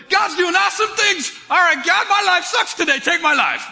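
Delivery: high, loud mock outburst; hammed-up despair. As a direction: wail to God in exaggerated frustration. Mimicking a swing from praise to despair.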